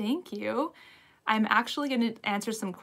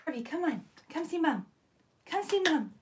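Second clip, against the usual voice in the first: coaxing the dog; 7 semitones higher than usual. Real, not acted. Coaxing shy dog to come.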